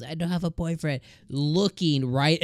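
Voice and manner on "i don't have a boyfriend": mild mock yell, pitched up; half-hearted yell of the line, a bit higher; retold yell. Yamada calling it out toward Ichikawa, a mild mock yell. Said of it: "And she yells".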